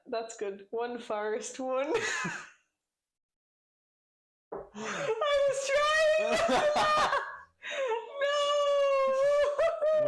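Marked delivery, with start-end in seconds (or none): 2.0-10.1 high, loud cry-laughing; laughing hysterically. Real, not acted. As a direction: crack up, wailing through laughter. Laughing fit after failed joke.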